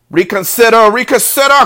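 high, loud, pleading goose; goose crying out. A narrator's reading. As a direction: beg urgently as a goose. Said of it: "cried the goose".

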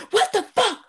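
high screechy imitation, near tears; mock freak-out. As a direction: squeal it like she's losing it. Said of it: "She's like".

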